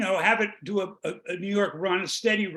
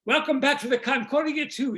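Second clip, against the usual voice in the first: put-on higher-pitched welcome in an Italian accent; 6 semitones higher than usual. Ship's captain welcomes passengers back on video, Italian accent. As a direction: play an Italian captain on the mic. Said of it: "You hear this in an Italian accent".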